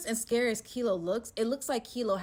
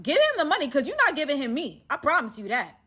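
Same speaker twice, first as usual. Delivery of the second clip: loud, high insistence; defending Bank Robber Bay, loud; defiant declaration; 6 semitones higher, 11 dB louder than usual. Retold or imitated. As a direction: stand your ground loudly. Defiant refusal to be taken.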